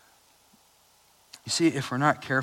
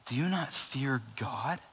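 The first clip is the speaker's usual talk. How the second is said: softer, slow earnest rebuke; grave rebuke; 9 dB quieter, slower than usual. Acted. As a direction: quietly confront him. Criminal rebukes the other.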